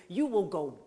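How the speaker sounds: ordinary talk, nothing marked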